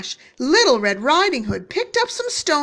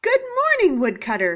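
put-on calling little girl; 8 semitones higher, 4 dB louder, faster than usual. A narrator's reading.

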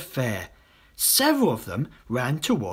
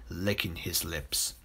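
usual speaking voice

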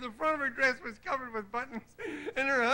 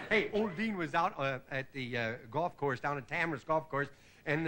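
ordinary talk

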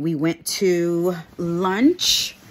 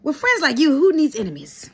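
mock indignation; 8 semitones higher, 4 dB louder than usual.

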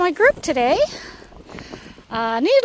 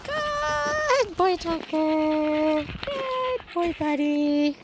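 squeaky praising a dog; much slower than usual. Real, not acted. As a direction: coo praise at the dogs. Praising dogs.

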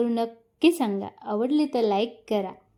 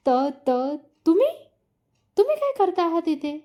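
frightened stammer; 4 semitones higher, faster than usual. A narrator's reading. Caught by Aarav in his room, a frightened stammer. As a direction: caught off guard, stammering higher. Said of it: "माई घाबरतच उभी".

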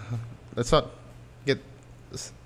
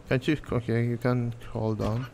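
usual speaking voice

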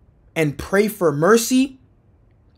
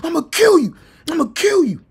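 high-pitched tearful threats; mad crying, imitated; 8 semitones higher, much faster than usual. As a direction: wail the threat like a bawling kid. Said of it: "Like, I'm mad crying".

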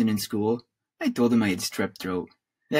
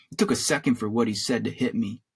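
usual speaking voice

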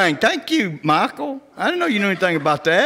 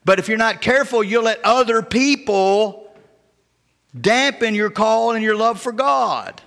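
slow preaching; 4 semitones higher, slower than usual. Acted.

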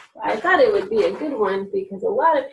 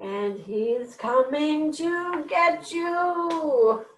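high, very slow, sing-song threat; teasing singsong monster warning; playful menace; 6 semitones higher, much slower than usual. A narrator's reading. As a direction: stretch every word like a chase game with children. Dog's teasing monster warning to listeners.

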